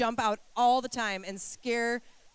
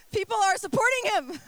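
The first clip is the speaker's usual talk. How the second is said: laughing, much higher, louder; 9 semitones higher, 5 dB louder than usual. Real, not acted. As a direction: laugh while you say it. Laughing at congregation.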